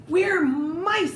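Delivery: high little squeak; squeaky mice. Acted. Troops answering their chief, high squeak. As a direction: admit it in a tiny voice. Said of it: "they squeaked".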